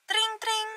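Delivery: high mock phone ringing tring tring; mimicking a phone going off; phone ring imitation. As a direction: chirp the ringtone up high and soft.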